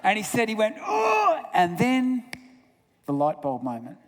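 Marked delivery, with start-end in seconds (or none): 0.8-1.4 sudden high-pitched cry of realisation; high exclaimed "oh". Retold or imitated. Grouchy dad struck by his daughter's words, high exclamation. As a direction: let out a mock cry as it hits home. Father's reaction acted out.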